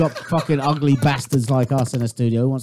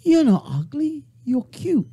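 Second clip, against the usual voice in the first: mock American woman; 10 semitones higher, slower than usual. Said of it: "American women go".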